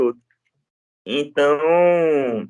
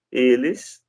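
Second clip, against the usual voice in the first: ordinary talk, nothing marked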